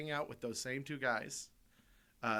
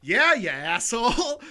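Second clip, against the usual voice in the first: high, loud, slow indignant yell; mock angry retort; 10 semitones higher, 12 dB louder, slower than usual. Retelling his comeback to a cohost, loud slow mock yell. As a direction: snap back loud and slow.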